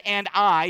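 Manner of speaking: "I" pressed hard and loud; emphatic I. Shown as a demonstration. Pastor showing the congregation the emphasis on "I". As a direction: land firmly on the word "I". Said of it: "It's emphatic there when he says".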